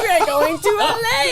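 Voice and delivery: high, hammed-up squeal of news; excited announcement. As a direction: burst in with the big news, pitch soaring. Runs in announcing news to co-driver.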